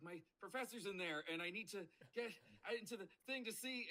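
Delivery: high-pitched, anxious mock plea; flustered pleading. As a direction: plead your way past the door. Acts out pleading at closed door.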